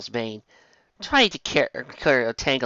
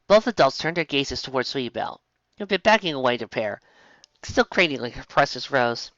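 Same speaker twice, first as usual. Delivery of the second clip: ordinary delivery, nothing marked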